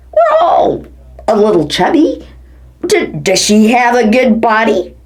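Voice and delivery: ordinary talk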